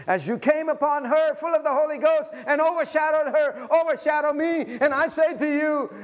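loud, high preacher's cry; full-force preaching. Acted. Pastor urging the congregation to be full of Jesus, preaching loud. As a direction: preach it out to the whole church.

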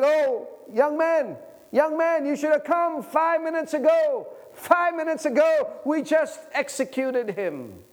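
high, drawn-out cry of dismay; anguished regret. Acted. Preacher as jailer telling the page he came too late, anguished cry.

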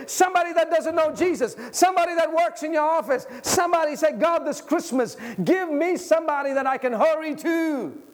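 high, driving urgent appeal; impassioned preaching. Real, not acted. Preacher urging listeners to find someone to reach, impassioned preaching.

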